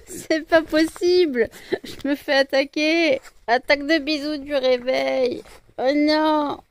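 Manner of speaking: hammed-up victim of kisses; mock distress. Owner to her dogs, loud playful protest.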